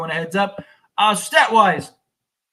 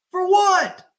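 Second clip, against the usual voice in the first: higher, louder repeated question; exasperated shout; 10 semitones higher, 4 dB louder than usual. Real, not acted. Fan demanding why, fed up shout.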